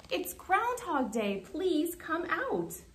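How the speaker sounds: high, stretched-out group call; storybook shout. A narrator's reading. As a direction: call slowly and high without much volume. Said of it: "said with a shout".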